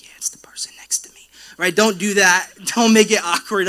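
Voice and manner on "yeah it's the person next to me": hushed, quick whisper; whispered aside. Pretend. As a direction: whisper it like a sneaky tip-off. Congregant blaming the neighbour.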